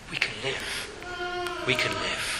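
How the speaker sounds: very soft, slow and reverent; hushed awe. Real, not acted. Preacher telling congregation Christ's curse brings life, hushed awe. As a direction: let it fall to a tender hush.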